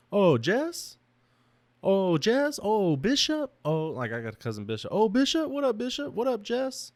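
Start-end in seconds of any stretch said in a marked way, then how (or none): 0.1-3.9 high rising "Oh, Jess?" calls; name as a question. Retold or imitated. Family teasing him with the brother's name, high rising questions. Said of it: "we just say their name in, like, a question". 4.9-6.9 high rising "What up, Jess?" greetings; playful name greetings. Retold or imitated. Cousins greeting each other by name, high rising playful calls. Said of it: "that's how we talk".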